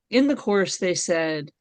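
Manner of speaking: ordinary talk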